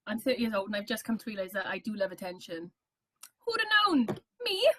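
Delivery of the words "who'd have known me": high, playful feigned astonishment; mock surprise. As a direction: act innocently amazed, pitched up high. Mock surprise at loving attention.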